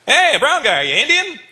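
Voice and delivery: high, loud call to the audience; retold shout across a room. Comic hailing a kid in the audience, calling out. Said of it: "I went".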